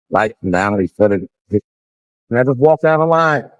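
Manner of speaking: normal talking voice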